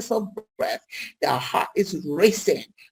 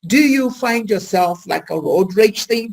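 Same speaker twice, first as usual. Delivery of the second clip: ordinary talk, nothing marked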